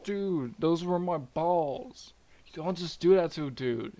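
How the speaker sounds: high, whiny indignant complaint; aggrieved Uranus protest. Acted. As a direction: protest like a wronged dude, stretched out. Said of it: "Uranus is like".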